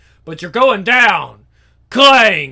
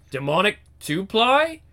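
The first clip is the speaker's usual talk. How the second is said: normal talking voice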